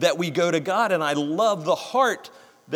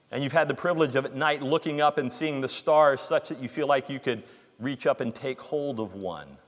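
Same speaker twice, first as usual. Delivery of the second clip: normal talking voice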